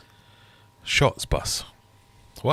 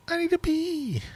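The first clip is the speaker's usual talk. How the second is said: much higher, cartoonish squeak; squeaky high voice. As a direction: squeak it out like a toon. Repeating the pee joke.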